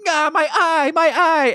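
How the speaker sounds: high hammed-up wail of pain; mock pained cry. Mankind after salsa in the face, high mock pained cry. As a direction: whine like a cartoon wrestler hit in the eye. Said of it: "Mankind's like".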